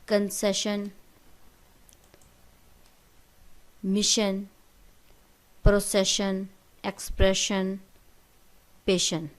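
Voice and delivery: teacher modelling the sh sound in پروسیشن; loud clear list of words with ش; example words. Shown as a demonstration. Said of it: "جیسے".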